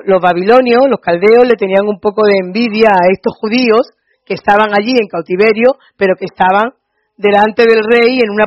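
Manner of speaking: loud, driving sermon delivery; preaching at full force. Acted. Preacher to the congregation, loud and driving. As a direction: preach it out to the whole hall.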